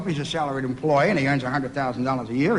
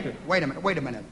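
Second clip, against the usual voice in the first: normal talking voice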